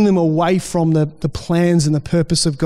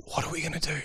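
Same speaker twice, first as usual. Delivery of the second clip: hushed worry; 4 semitones lower, 16 dB quieter, much faster than usual. Real, not acted. Anxious question, voice drops away.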